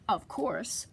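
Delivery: usual speaking voice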